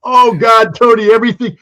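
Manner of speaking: hammed-up anguished Blinken; mock despair. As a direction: groan it like a doomed aide. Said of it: "It's just like".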